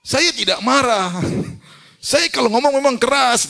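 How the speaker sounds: high, loud, slow indignant shouting; mock shout. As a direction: mimic a loud man insisting he isn't angry. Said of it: "dia teriak". (teriakan pura-pura marah)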